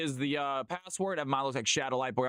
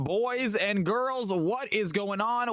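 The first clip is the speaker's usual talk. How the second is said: high, slow showman welcome; hype announcer; 7 semitones higher, 3 dB louder, slower than usual. Acted. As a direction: greet the crowd like a ring announcer. Streamer's hype intro.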